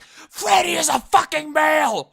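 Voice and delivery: high, loud mocking shout; imitating his old angry self; mock angry yell. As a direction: yell it like a furious fan. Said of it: "I was originally one of these guys, like".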